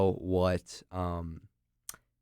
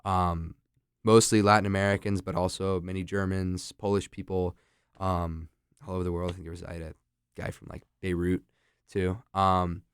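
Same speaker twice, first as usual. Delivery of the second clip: ordinary talk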